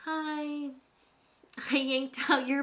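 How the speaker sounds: timid little voice admitting a mistake; sheepish confession. Retold or imitated. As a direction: confess it meekly, very slowly.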